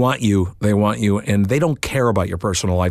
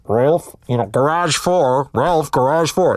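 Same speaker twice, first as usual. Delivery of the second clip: slow, higher PA announcement; loudspeaker page; 4 semitones higher, slower than usual. Retold or imitated. Loudspeaker paging Ralph over the dealership during the recording, slow and higher.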